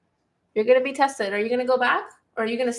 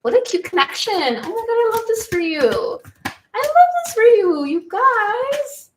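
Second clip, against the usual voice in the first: gushing delight; 10 semitones higher, 5 dB louder than usual. Real, not acted. Gushing over the connection.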